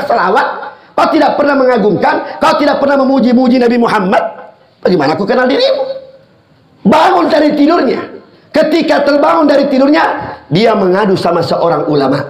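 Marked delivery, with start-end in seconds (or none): none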